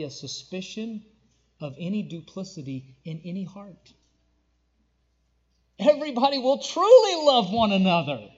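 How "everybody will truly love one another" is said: loud, high, fast rhetorical questions building; preaching at full force. Acted. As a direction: drive the climax home to the crowd. Preaching swell again.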